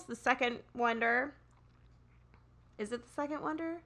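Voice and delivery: normal talking voice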